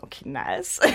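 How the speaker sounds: low breathy aside; whispered thought. Retold or imitated. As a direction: whisper it to yourself. Inner thought quote.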